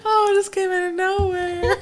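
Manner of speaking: high, tearful laughing voice; real giggling fit; helpless laughter. Real, not acted. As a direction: squeal it out while cracking up. Cracking up over the accent slip.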